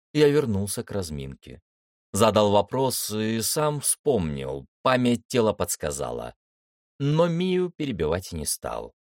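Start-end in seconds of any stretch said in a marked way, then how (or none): none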